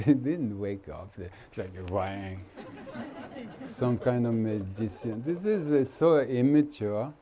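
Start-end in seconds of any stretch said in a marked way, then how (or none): 0.0-3.7 low, soft words through chuckles; amused laughing. Real, not acted. Teacher joking about Jesus waking the dead, amused laughing. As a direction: sink into a quiet laugh while talking. Mocks literal reading of miracle.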